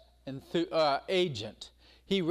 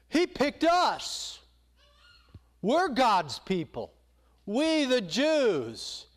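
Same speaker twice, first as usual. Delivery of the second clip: mock pride; 7 semitones higher, much slower than usual.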